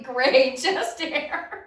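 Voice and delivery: usual speaking voice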